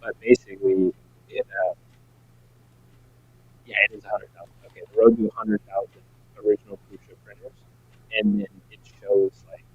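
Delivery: glitchy, cutting-out call audio; breaking up audio. Real, not acted. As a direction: voice dropping out on a bad line. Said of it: "your audio is breaking up a little bit now".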